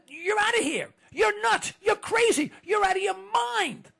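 high, loud angry shouting; loud outraged shout. Pretend. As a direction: yell it at the officials. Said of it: "why did we just say".

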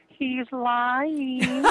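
put-on high, sweet little old lady voice. An elderly wife chiming in from the background, sweet and high. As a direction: pipe up like a tiny sweet grandma. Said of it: "sweet little older voice in the background say".

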